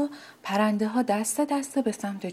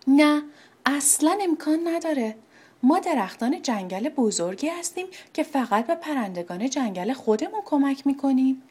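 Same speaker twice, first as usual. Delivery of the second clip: higher and louder, a harsh bitter no; bitter refusal; 5 semitones higher, 4 dB louder than usual. Acted. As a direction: slam the door on the little bird. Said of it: "به تلخی گفت". (ردّ تلخ و تند)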